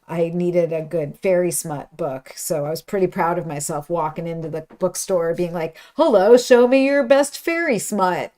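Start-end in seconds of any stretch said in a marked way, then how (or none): 6.0-8.3 higher, louder, hammed-up greeting; proudly hammed-up shop request; mock bold request. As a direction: announce yourself proudly, playing it up. Retelling own bold bookstore request.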